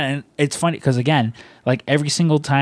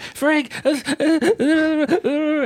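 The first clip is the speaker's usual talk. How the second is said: high, garbled flustered babble; hammed-up panic into the phone; mock panic; 14 semitones higher, much slower than usual. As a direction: squeal it, words dissolving into stammers. Acts panicked phone call.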